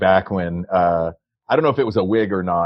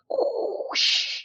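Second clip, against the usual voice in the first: soft breathy whoosh, drawn out; whoosh sound; 7 dB quieter than usual. Retold or imitated. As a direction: imitate a soda stream hiss. Imitating soda stream sound.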